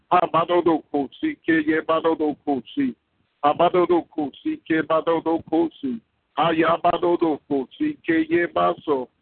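high ecstatic tongues; speaking in tongues. Real, not acted. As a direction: pour out tongues in rapture. Speaking in tongues mid-prayer.

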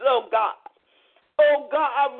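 ordinary talk, nothing marked

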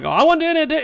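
put-on high eager Boston accent; light imitation of a Boston accent. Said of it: "And he had a Boston accent".

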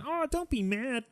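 high, slow mock cooing; hollow sympathy. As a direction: coax like a useless consoler. Imitating hollow comforting.

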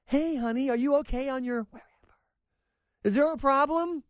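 high, sing-song mock mom voice; lightly hammed-up concerned parent; mock worried parent. Mock parent asking daughter euphemistically.

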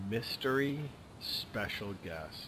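slow, hushed mock mystery; mysterious hush. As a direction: breathe it like a secret reveal.

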